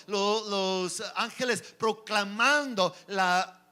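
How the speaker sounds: ordinary delivery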